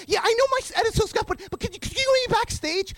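high, stammering plea; re-enacted eager begging; pleading. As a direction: concede hastily, then beg a favour. Pleading to get backstage.

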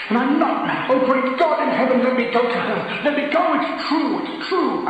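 high, loud desperate pleading; husband pleading frantically with his friend; crying out. A narrator's reading. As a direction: beg to be let go, voice straining. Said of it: "he cried".

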